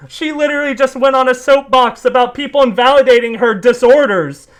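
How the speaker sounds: high, loud furious rant; genuinely pissed off rant rising to a yell; real anger. Real, not acted. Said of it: "I am actually f***ing pissed off right now".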